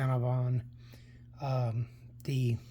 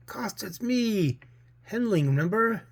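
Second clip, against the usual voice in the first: ghostly caller; 7 semitones higher than usual. Acted. Dead comrade calling Costa from the gloom, eerily.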